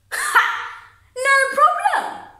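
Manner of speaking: loud, high, drawn-out sarcastic repeat; scornful echo. Acted. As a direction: throw your own words back in loud, slow mockery. Bitterly mocks own earlier answer.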